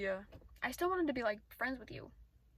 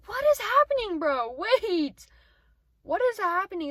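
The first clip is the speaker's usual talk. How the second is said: loud, high alarmed "what is happening"; startled outburst; 8 semitones higher, 12 dB louder than usual. Real, not acted. Watching a scene outside the car, alarmed. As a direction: blurt it out, genuinely startled.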